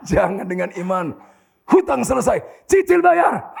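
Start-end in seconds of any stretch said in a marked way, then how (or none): 1.7-3.5 loud, high proclamation with a laugh; mock faith declaration. Preacher mimics declaring debts gone by faith, loud mock proclamation. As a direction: declare it boldly, laughing at the end.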